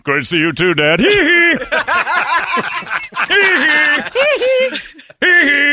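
high squeaky hee hee. Acted. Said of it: "That was [name]".

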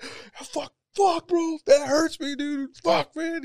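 high whiny imitation of a heartbroken guy; mock hurt friend. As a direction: whimper it, hammed-up hurt. Voicing hurt Alex.

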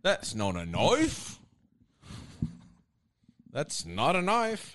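slow, drawn Aussie "That's not a knife"; slow Australian accent; mock Australian. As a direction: drag it out Aussie style. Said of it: "don't even do the accent".

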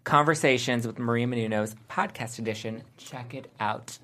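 ad read. Acted. Hosts read a podcast ad.

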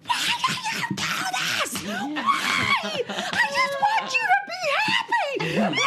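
hammed-up wail, extremely high; mock sobbing. Mother sobbing at her crying daughter, hammed-up high wail. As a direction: wail it as a tearful, squealing plea. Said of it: "I'm sobbing".

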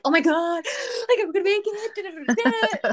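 high, loud, gasping meltdown; hammed-up wail dissolving into babble; mock hysterics. Wife showing how not to call deployed husband, hysterical. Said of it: "call them and be like".

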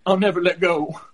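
a hint of a cartoon girl; South Park girl voice. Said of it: "You sounded like a South Park character".

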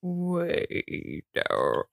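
copying her friend's deep voice; mock deep voice. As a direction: echo the spooky deep voice playfully. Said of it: "Corey said my deep voice is really good".

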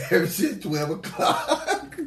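high, drawn-out laughing words; stretched words cracking into laughter. Real, not acted. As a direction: laugh right through the line. Laughing through the answer.

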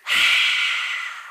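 breathy roaring exhale; whispered lion roar out the mouth; lion breath roar. Shown as a demonstration. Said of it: "make a roaring sound like a lion".

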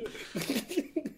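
high burst of laughter. Real, not acted. Laughing at joke.

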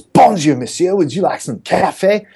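high, chirpy mock French waiter; perky fake French accent. Waiter offering coffee at four thirty, perky fake French accent. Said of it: "He was a little perky for four thirty".

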